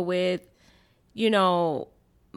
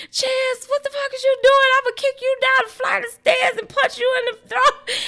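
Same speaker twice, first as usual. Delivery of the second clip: high, loud hammed-up mom threatening; mock angry mom; 4 dB louder than usual. Son imitating his mom yelling at him, high mock fury. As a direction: play the screaming mom, pitched way up and laughing. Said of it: "and you was just coming there".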